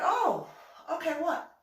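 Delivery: very high, loud, piped-up reply; perky mock surprise. Retelling own reply to offered help, perky surprise. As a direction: jump up in pitch with interest.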